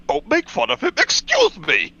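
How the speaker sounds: high-pitched indignant protest; mock offended fan. Retelling a fan defending Snorlax, high and offended. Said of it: "He's like".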